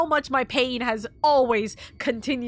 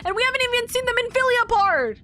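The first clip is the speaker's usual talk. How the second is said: excited; 8 semitones higher than usual. Real, not acted. Excited about upcoming story part.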